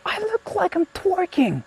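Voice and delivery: very high and slow, drawn-out wail; mortified squeal. Real, not acted. Streamer stares at own pose, slow high mortified wail. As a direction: stretch it out in squeaky disbelief.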